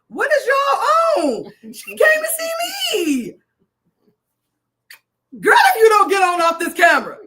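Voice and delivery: high loud laughing yell; playful screaming disbelief; laughing shout. Real, not acted. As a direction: holler at them through laughter. Teasing shout at viewers.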